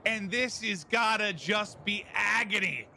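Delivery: loud, slowed hype call; excited call. Real, not acted. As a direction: build up the round win. Caster calls the round win.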